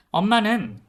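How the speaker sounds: plain reading voice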